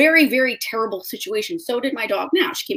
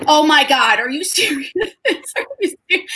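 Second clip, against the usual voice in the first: high, laughing and near tears; half laugh, half cry, amazed; laughing in disbelief; 6 semitones higher, 3 dB louder than usual. Retold or imitated. Woman meeting her new dog, laughing through tears. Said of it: "And I was like".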